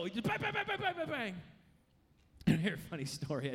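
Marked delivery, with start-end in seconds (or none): 0.3-1.3 rapid high bang bang gunfire sounds; imitated gunshots. Preacher mimics gunfire for the congregation, rapid high bangs. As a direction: fire off the bangs like a gunslinger emptying his gun. Gunslinger firing when God says go.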